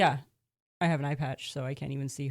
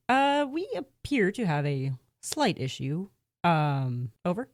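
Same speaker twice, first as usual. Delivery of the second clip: slow, careful, louder report; slow nervous report; 4 dB louder, slower than usual. Acted. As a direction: stall while admitting the problem.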